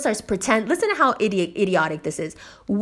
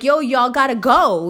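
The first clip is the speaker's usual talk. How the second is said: louder, raised-pitch dismissal; retold send-off; 4 semitones higher, 6 dB louder than usual. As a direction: shoo guests out briskly. Retelling sending the guests out.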